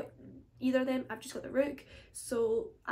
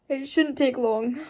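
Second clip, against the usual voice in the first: laughing; 10 dB louder, slower than usual. Real, not acted. Laughs at own disclaimer.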